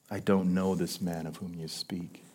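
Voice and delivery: very soft, low disowning; quiet, deflated denial; hushed denial. Acted. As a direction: drop to a near-murmur, grave and low. Denial set against bold vow.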